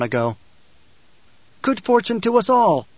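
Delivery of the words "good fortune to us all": high-pitched ceremonial call; raised, ringing hail. A narrator's reading. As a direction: call out a traditional greeting. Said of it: "he called in the traditional Fremen way".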